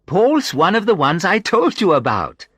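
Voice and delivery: high, loud, gleeful woman's voice; amused. A narrator's reading. Sandra presents Paul to the visitor, amused. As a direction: introduce him with amused delight. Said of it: "Sandra laughed".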